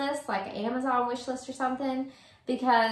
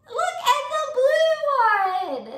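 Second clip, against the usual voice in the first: squealing delight; 13 semitones higher, 6 dB louder, faster than usual. Real, not acted. Opening birthday gifts, overwhelmed.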